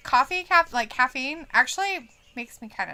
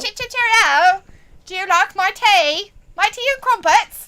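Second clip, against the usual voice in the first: high, loud hammed-up British accent; louder, higher cartoon British accent; mock British accent; 7 semitones higher, 5 dB louder than usual. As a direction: overdo the British toff with tea and crumpets. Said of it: "exactly what he sounds like".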